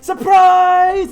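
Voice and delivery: high, drawn-out party yell; surprise shout. Acted. Guests springing a birthday surprise, loud high shout. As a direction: shout it out like a whole room jumping out.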